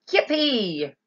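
loud, gleeful shout; joyful shout. A narrator's reading. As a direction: whoop it out. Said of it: "shouted Grandpa Joe again".

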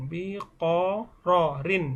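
বিকররিন very slowly, each syllable drawn out and separate. Shown as a demonstration. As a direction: break বিকররিন into long, separate syllables for a learner. Repeats hard word slowly for learners. (বিকররিন খুব ধীরে, প্রতিটি অক্ষর আলাদা করে টেনে)

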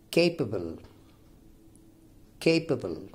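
"capable" with the stress on CA; first-syllable stress. Shown as a demonstration. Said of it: "stress here falls on the first syllable".

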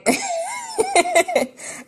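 a squealing high laugh; high breathy laugh. Real, not acted. Laughing at her own story.

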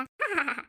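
short ha-ha-ha of delight; grandmother's happy chuckle; delighted laugh. Acted. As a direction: laugh along with the baby.

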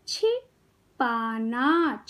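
छिपाना very slowly, pause after छि, long पाना; syllable by syllable. Shown as a demonstration. Teacher teaching kids to read, syllables apart. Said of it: "शब्द पढ़ना सिखाऊंगी".

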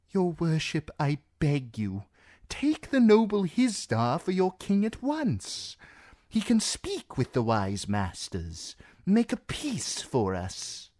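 lightly voiced anxious seneschal; pleading courtier. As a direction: coax her with nervous flattery. Reznak pleads with queen.

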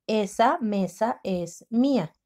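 slow, each word spaced; slow careful reading; slow dictation. Shown as a demonstration. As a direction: read it slowly for writing. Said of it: "La palabra mía lleva acento".

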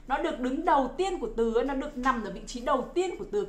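normal talking voice

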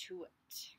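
soft whispered ending. A narrator's reading. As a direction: breathe it out.

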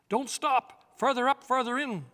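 high, loud eager call from the air; ringing call over the wind. Acted. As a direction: call the others onward, urging them not to stop. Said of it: "called Farsight".

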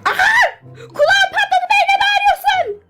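very high, shrill-pitched protest; pained outcry. Acted. Protesting at friend's scream in her ear, high pained shout. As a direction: yelp as if deafened.

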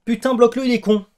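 high, loud frantic urging; exasperated shout. Real, not acted. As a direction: yell it at your teammate to act. Urges teammate to block, escalating.

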